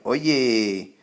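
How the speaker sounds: imitated attention-getting call, "Oye" drawn out; mild mock yell. Teacher imitating people trying to get someone's attention, mild mock yell. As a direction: call out a long "Oye" without fully yelling. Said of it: "you'll hear them yell".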